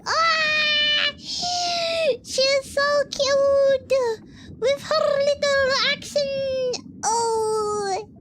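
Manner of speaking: high, loud, drawn-out gush; squealing adoration. Real, not acted. Fan swooning over her little accent, squealing adoration. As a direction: squeal over how cute she is.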